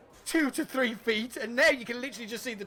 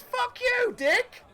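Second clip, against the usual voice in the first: put-on old man yelling; 3 dB louder, much slower than usual. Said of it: "he goes".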